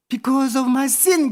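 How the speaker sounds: put-on very slow, high-pitched child's English; imitated desperate child. Three-year-old using overheard English under pressure, slow imitated plea. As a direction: stretch out the words as a cornered little kid. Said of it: "애가 급하니까 주워들은 영어로".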